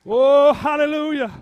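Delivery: loud, high hallelujah; shouted praise. Real, not acted. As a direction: cry out praise from the pulpit. Exclaims praise at sermon's peak.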